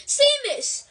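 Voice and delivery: high, loud roar of a name. A narrator's reading. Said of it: "roared P-, Professor Lupin".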